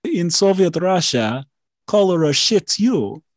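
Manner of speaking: corrected punchline in a hint of a Russian accent; slightly put-on Russian joke voice; a touch of a Soviet Russia accent; mock Russian accent. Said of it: "It should be".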